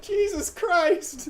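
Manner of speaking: high, loud mock shock; imitated high-pitched cry of alarm; startled exclamation. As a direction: yelp it out slowly, acting startled. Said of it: "And Mario's like".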